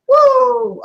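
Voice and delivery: high, loud "woo"; celebratory whoop. Acted. Host cheering their own praised idea, high whoop. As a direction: let out a high mock-triumphant whoop.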